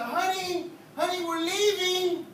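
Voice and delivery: high, slow drawn-out call; calling out to wife. Acted. Preacher calls to his wife on the way out, slow high call. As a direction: holler it sweetly out the door.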